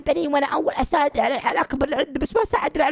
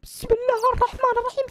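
ordinary delivery

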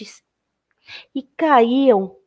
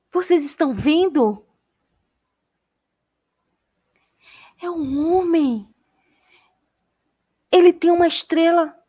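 much higher, eager calling out; excited children; 8 semitones higher than usual. Acted. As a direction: kids pointing at the horizon in wonder. Children spot a man.

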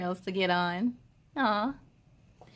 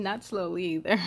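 ordinary delivery

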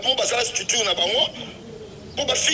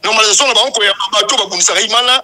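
loud, forceful charge; heated accusation; 9 dB louder than usual. Real, not acted. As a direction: accuse them at full volume. Heated accusation against the committee. (kofunda na mongongo ya makasi)

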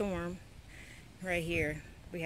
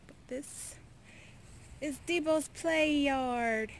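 normal talking voice

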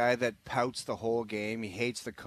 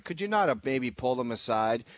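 ordinary talk

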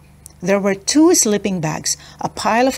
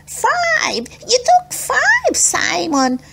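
put-on high, squeaky accusing kid voice; slower than usual. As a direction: point the finger, shrill. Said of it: "Smokey Joe said accusingly".

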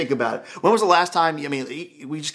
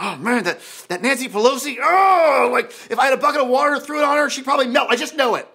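mock outraged viewer; 5 semitones higher than usual. Preacher imitates cable news viewer fuming about Pelosi, exasperated. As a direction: imitate a viewer worked up at a politician.

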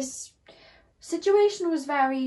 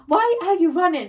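higher and louder "Why are you running?", calling after her; comic imitated shout, pitched up; mock yelled plea; 7 semitones higher, 10 dB louder than usual. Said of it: "the guy's like".

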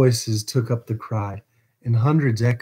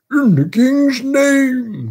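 high, loud, drawn-out call; ringing shout from outside; crowd's cry; 7 dB louder, much slower than usual. A narrator's reading. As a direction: shout a slow official demand through the door. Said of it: "cried the voices without".

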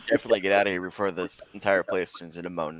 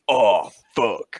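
mock baritone groan; deep baritone moan; 3 dB louder than usual. Player moaning at the first bite of quiche, mock baritone. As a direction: let it out as a lightly put-on deep moan. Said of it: "this deep baritone voice comes out of you".

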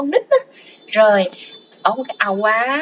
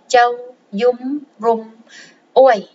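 slow "Châu, dung, rum, uối" with a break before "uối"; word by word; slower than usual. Shown as a demonstration. Teacher breaking down a Khmer phrase for learners, slowly word by word.

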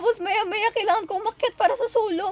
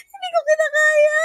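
laughing; 11 semitones higher, 8 dB louder than usual. Real, not acted. Laughing overwhelmed.

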